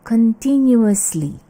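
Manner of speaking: CONTINUOUSLY slow and loud, con-TIN-yu-us-ly; correct continuously. Shown as a demonstration. Teacher models the adverb, careful pronunciation. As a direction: spell out each syllable of CONTINUOUSLY. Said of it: "Bagaimana mengucapkannya?".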